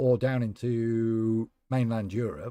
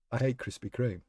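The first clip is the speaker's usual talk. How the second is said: ordinary talk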